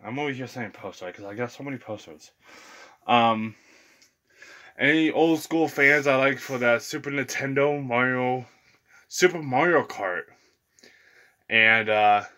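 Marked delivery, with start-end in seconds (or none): none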